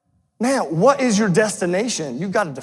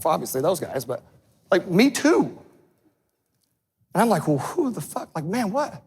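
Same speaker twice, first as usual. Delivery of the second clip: muttered self-doubt; 5 dB quieter than usual. Real, not acted.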